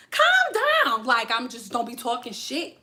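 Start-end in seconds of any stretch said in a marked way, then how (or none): 0.1-1.0 high, squealed plea; put-on high voice telling a friend off; high-pitched exclamation. Acted reply to Aries friend.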